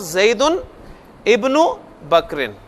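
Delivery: "ज़ैदुन", "इब्नु", "बकरिन" read separately with pauses between; word-by-word reading. Shown as a demonstration. Teacher showing students the separate words, word-by-word reading. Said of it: "अभी मैं अलग-अलग इन्हें पढ़ रहा हूँ".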